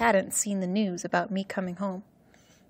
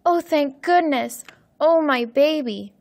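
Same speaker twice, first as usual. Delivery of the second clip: high, loud gush of relief; overjoyed, clutching her child back; mother greeting her lost son, loud and high with relief; relieved cry; 7 semitones higher, 6 dB louder than usual. Acted. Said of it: "she cried".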